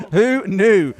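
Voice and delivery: laughing through the words; chuckling as you repeat it. Real, not acted. Repeats quip while laughing.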